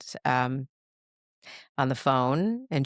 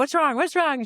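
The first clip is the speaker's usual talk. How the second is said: high, panicked what's wrong, loud; friend freaking out; 13 semitones higher, 3 dB louder than usual. Retold or imitated. A friend on the phone panicking, asking in high alarm. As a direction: mimic her friend's alarmed pitch shooting up high. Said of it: "and she's like".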